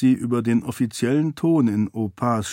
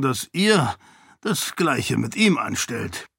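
ordinary delivery

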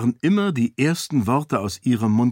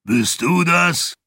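high, drawn-out hoarse call; booming, raspy question; rough booming; 6 semitones higher, 3 dB louder, slower than usual. A narrator's reading. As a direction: bellow through a sore throat. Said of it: "raue Stimme war ihr eigenartig vertraut".

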